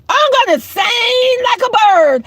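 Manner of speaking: emphatic repeat. Real, not acted. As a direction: belt out "sing like a bird" as a threat. Emphatic repeat of her threat.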